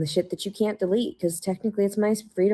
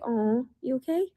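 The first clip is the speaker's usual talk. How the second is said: higher, soft cooing question; babying tone; 6 semitones higher, 3 dB quieter than usual. Retold or imitated. Babying the officers in a sweet coo. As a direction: coo it like to a child. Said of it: "I baby you and I'm like".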